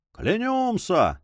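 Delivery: drawn-out, high cheer of assent; high, slow, rapturous vow without shouting; elated cry. A narrator's reading. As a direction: swear it with delight, stretching the word out slowly. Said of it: "с восторгом вскричали".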